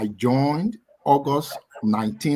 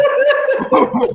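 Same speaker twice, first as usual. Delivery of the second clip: high-pitched laughing shriek; squealing laugh; high burst of laughter; 7 dB louder than usual. Real, not acted. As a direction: crack up loudly. Laughs at juju joke.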